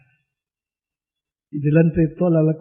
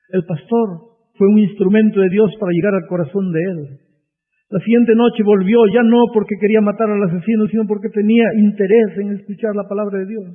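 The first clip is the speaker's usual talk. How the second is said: loud, high, driving sermon delivery; full-force revival preaching, climbing higher; 8 semitones higher, 5 dB louder than usual. Acted. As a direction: hammer each phrase at the congregation. Preacher builds the story.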